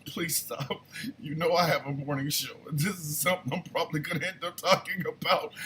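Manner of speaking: acted-out plea under the breath; hushed pleading. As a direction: beg quietly, trying not to crack up. Begging wife to stop, retold.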